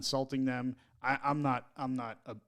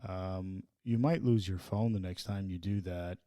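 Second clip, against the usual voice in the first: lower, calm, soothing parent voice; mock soothing; 6 semitones lower than usual. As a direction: talk the kid down gently, half joking. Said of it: "Very calm, very soothing".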